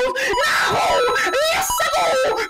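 shout. Acted. Shouted cry.

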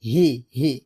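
হি with a light h from the bottom of the throat; unforced, airy h in হি; light thin ha. Shown as a demonstration. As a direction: say হি thinly, no pressure. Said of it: "শুরু থেকে পাতলা করে উচ্চারণ করব".

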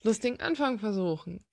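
ordinary talk, nothing marked

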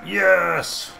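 loud, high, drawn-out cheer; triumphant cry. Real, not acted. Gamer celebrating a kill, triumphant shout. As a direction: exclaim it in victory.

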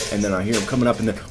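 ordinary talk

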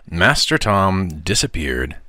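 usual speaking voice

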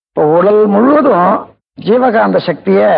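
normal talking voice

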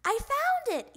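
high, loud discovery shout; excited little frog's shout; triumphant yell. A narrator's reading. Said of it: "yelled Froggy".

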